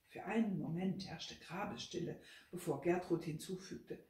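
ordinary delivery, nothing marked

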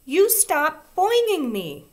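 high, loud, slow furious demand; angry child shriek. A narrator's reading. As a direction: order her to stop, shrieking. Susan shrieks angrily.

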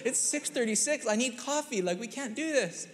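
high, pleading complaint; tired dad pleading with his four-year-old. Retold or imitated. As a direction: beg him to stop, half laughing. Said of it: "I'm like".

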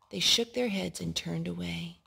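plain reading voice

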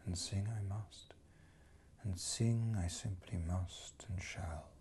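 intimate whispered vow; a secret sung promise, whispered. Acted. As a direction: breathe the words out unvoiced. Poem drops to whisper.

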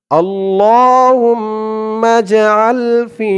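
আল্লাহুম্মা drawn out very slowly with long hold; slow recitation. Shown as a demonstration. Teacher modelling pronunciation and elongation, slow recitation. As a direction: intone আল্লাহুম্মা with a long stretch. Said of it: "একালিফ টান".

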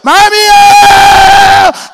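loud, slow high sob; drawn-out crying wail. Retold or imitated. Child wailing to his mother after the beating. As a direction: wail it out long.

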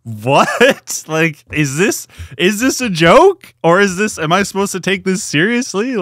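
high-pitched, slow incredulous questioning; comically bewildered, voice climbing; baffled disbelief. Acted. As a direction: ask as if you truly can't believe it. Acting out baffled reaction to show.

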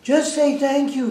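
loud, high, urgent plea; retold pleading at full volume; begging. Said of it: "begging her".

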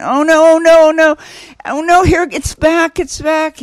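a half-joking panic as the pain returns; woman in labor feeling the contraction come back, in mock alarm.

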